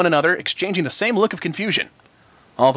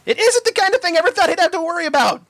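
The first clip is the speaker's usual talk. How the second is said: high loud terrified outburst; filly screaming in terror; panicked scream; 14 semitones higher, 3 dB louder than usual. A narrator's reading.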